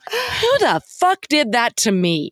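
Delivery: high, anguished cry of betrayal; indignant outburst. Retold or imitated. Woman feeling conned by the film's line, crying out high. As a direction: cry out like you've just been wronged. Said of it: "the immediate thought in my head is like".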